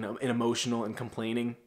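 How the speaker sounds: ordinary talk, nothing marked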